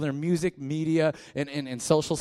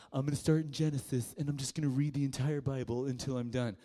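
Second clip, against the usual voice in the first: mock naive reader; 5 dB quieter than usual. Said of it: "You're like".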